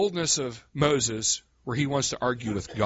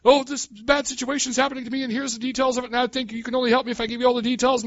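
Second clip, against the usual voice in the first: higher, rushed explanation; mock rambling plea; 10 semitones higher, faster than usual. Preacher mocking a long, rushed, detailed cry for help. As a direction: gabble out every detail. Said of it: "You're not trying to scream out in detail".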